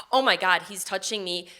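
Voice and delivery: ordinary talk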